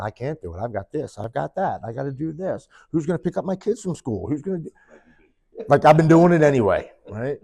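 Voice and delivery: rising, mounting list of excuses; talking himself out of going. Retold or imitated. Man on his bed arguing himself out of rehab, mounting protest. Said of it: "I'm talking myself out of going".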